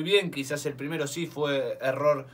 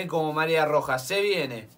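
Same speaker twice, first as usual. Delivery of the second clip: ordinary talk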